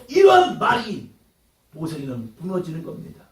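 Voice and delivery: ordinary talk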